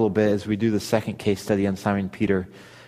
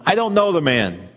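louder, higher swearing outburst; cursing denial; 5 semitones higher, 5 dB louder than usual. Acted. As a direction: curse and swear you don't know him. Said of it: "began to call down curses on himself and swore".

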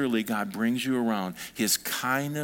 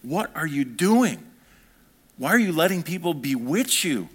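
fired-up rebuke; 3 semitones higher than usual. Acted. Preacher as Paul scolding the Galatians, fired up. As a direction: demand an answer, stretching the words. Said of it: "he was fired up".

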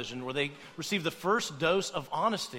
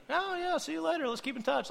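much higher, sing-song fake friendliness; breezy fake promise to stay in touch; fake cheerful goodbye; 12 semitones higher than usual. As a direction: gush it like you mean none of it. Mock cheerful goodbye lie.

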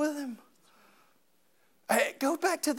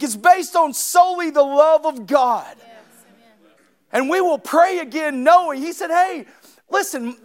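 loud, raised preaching to the congregation; full-force preaching; 7 semitones higher, 7 dB louder than usual. Acted. Pastor urging his church on prayer, preaching loudly. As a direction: drive it home from the pulpit.

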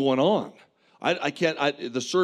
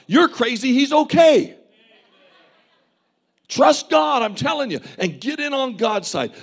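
loud, high, driving sermon; full-force preaching; 5 semitones higher, 4 dB louder than usual. Acted. Preacher urging the congregation to trust God, at full force.

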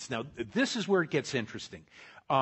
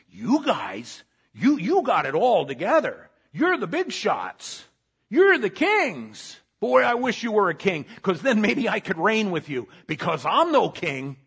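sarcastic; 6 semitones higher, 8 dB louder than usual. Retold or imitated. Paul mocking the proud Corinthians, loud sarcastic praise. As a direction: flatter them so they hear the sneer. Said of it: "are you catching the sarcasm here".

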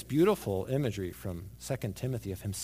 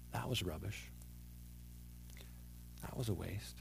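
quiet regret; 5 semitones lower, 14 dB quieter than usual. Pretend. Imagined listener's quiet regret.

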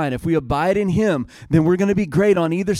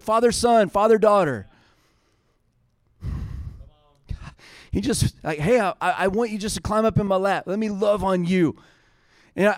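high-pitched, fervent sermon delivery; impassioned preaching; 6 semitones higher, 3 dB louder than usual. Real, not acted. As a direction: drive the message home to the congregation.

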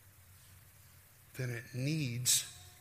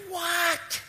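very high, loud squeal of disbelief; astonished what; 7 dB louder than usual. Acted. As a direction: squeal it in mock amazement. Acted astonishment at a revelation.

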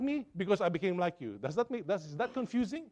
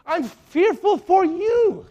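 louder and slow, drawn-out warning; loud fearful plea; 7 semitones higher, 11 dB louder, much slower than usual. Retold or imitated. Paul pleading with wayward believers, loud and slow. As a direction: cry it out slowly so they feel the danger. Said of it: "And yet you want to".

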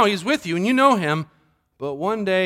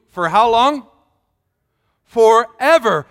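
Forever drawn out slow, louder and higher; stress on Forever; 5 semitones higher, 8 dB louder, slower than usual. Real, not acted. Preacher driving home to the congregation that the Spirit stays, stressing Forever. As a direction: lean hard into Forever, slow and loud.

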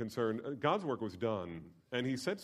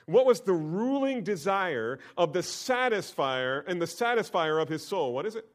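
louder, higher, slowed rhetorical questions; fervent preaching; 8 semitones higher, 8 dB louder than usual. Acted. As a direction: press the congregation with rising questions. Preaching at full force.

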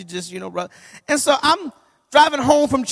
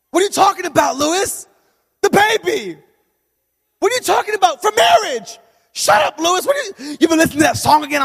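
higher, louder incredulous wife; a hint of an exasperated wife; 8 semitones higher, 5 dB louder than usual. Wife scolding her husband about the song, exasperated. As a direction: play the baffled wife scolding him. Wife's reaction voiced.

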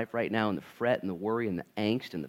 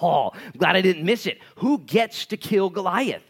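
put-on boy thrilled to be at the battle; excited young David; 9 semitones higher, 8 dB louder than usual. David arriving at the battlefield, eager and excited. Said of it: "He's like".

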